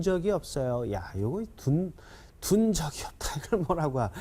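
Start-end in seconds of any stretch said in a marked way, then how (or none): none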